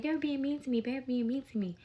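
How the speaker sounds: mock a child's tearful complaint, lightly; crying child. Daughter on the phone to Mommy, imitated crying complaint. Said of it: "So she's crying, crying".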